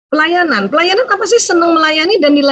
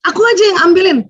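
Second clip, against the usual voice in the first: put-on eager child; 7 semitones higher, 3 dB louder, much faster than usual. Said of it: "mulai rubah gitu".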